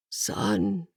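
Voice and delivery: faint, failing sick man's whisper. A narrator's reading. Dying Reverend calling Hans, a soft whisper. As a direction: breathe the word out softly. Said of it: "The word was a whisper".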